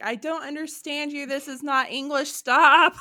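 rising to a loud cry on stop; baffled elder losing patience, hammed-up; exasperated old person. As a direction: build in mock exasperation to a shout. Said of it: "I used to be that old person".

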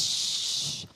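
long, unvoiced shush. Moses hushes the panicking Israelites, long shush. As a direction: hush a whole crowd. Imagined Moses hushing the crowd.

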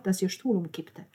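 ordinary delivery, nothing marked

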